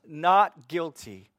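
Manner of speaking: slow, loud, firm pronouncement; a preacher voicing God as judge, slow and emphatic; judge's verdict. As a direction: declare it like a judge banging the gavel.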